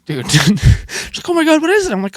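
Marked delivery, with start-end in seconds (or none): 1.3-1.9 high-pitched imitation of an excited local; mock starstruck curiosity; stoked shop lady. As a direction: play her eager question higher. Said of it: "They're so stoked when they hear that".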